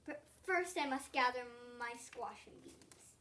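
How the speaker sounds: plain reading voice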